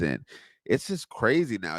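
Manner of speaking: ordinary talk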